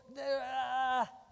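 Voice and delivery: strangled cry, high and loud; a short shout as if grabbed; choked-off cry. Pretend. Airán grabs him mid-demo.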